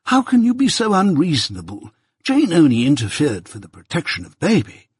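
pleading protest, starting high; mildly exasperated appeal to reason; remonstrating. A narrator's reading. Mister Pocket defending Jane to his wife, remonstrating. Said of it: "remonstrated Mister Pocket from the other end".

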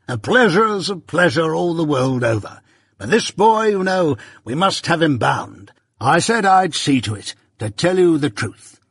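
high-pitched, self-important assurance; pompous Pumblechook, officious. A narrator's reading. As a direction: press the point grandly. Voices Pumblechook busily presiding.

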